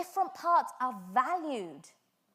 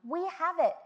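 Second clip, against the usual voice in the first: loud, high, slow emphatic preaching; full-force preaching; 5 semitones higher, 8 dB louder than usual. Acted. Preacher rallying the congregation, loud and slow. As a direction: drive it home to the congregation.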